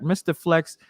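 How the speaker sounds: ordinary talk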